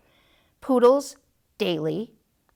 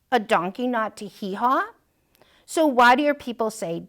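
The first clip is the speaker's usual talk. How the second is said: usual speaking voice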